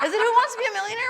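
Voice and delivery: fast, high, breathless guess; breathless excitement. Real, not acted. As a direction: blurt it out in a rush. Blurted guess in frantic recall.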